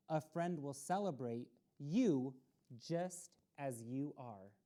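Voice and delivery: a steady picture-book reading voice, pausing between pages; storybook read-aloud. A narrator's reading. Children's story host reading the book to young listeners, read-aloud voice.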